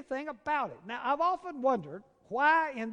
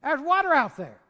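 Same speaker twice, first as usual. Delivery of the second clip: ordinary talk, nothing marked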